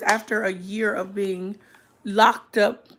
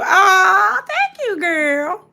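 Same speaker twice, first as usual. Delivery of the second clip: excited thanks; 10 semitones higher, 8 dB louder, much slower than usual. Real, not acted. Thanking a viewer for support.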